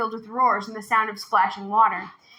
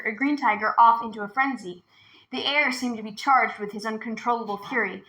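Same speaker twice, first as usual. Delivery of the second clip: usual speaking voice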